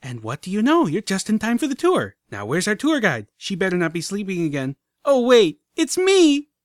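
high, loud, nonstop chatter; breathless excitement. A narrator's reading. Filly starts the tour, breathless and excited. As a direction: rattle on without a breath. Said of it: "didn't even stop for breath".

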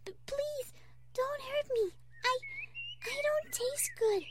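very high, timid stammering plea; frightened stutter. Pretend. Fluttershy begging the pretend wolf, scared stutter. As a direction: plead haltingly in a small high voice. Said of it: "she stuttered".

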